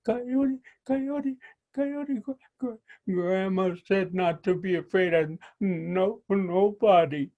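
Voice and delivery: high, small, stuttering turtle voice; a timid little turtle trying to sound brave; stammering little turtle. Acted. Little turtle stammers at Coyote, high and shaky.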